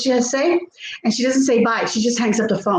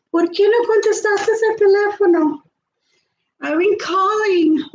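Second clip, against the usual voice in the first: higher nagging mother-in-law, laughing through it; mother-in-law impression; 7 semitones higher than usual. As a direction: play the mother-in-law scolding over the phone, higher. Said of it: "I've gotten it down packed".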